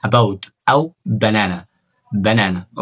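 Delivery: about and banana with a light unstressed uh, slowly; barely touch the a in about, banana; weak schwa. Shown as a demonstration. Teacher modelling reduced a in banana. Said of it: "تنطقها حرف ميت جدًا".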